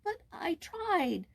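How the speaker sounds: high, slow, timid protest; a frightened boy pleading; scared boy excusing himself, high and slow. A narrator's reading. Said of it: "began Jules timidly".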